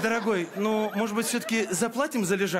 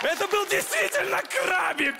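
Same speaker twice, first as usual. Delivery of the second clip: insistent shout; 7 semitones higher than usual. Acted.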